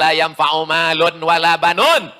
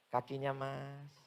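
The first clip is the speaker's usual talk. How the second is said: much softer, slow and polite; gentle Jogja politeness; 4 semitones lower, 24 dB quieter, slower than usual. Retold or imitated. Preacher imitating a polite Jogja man whose foot was stepped on, very soft. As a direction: murmur it like a mild-mannered Javanese man. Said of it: "orang Yogyakarta itu kan lembut".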